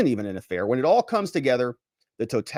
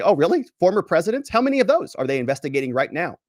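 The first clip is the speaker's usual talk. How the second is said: higher, louder mock incredulity; sarcastic challenge, higher and louder; sarcastic retort; 6 semitones higher, 4 dB louder than usual. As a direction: jab back with exaggerated disbelief.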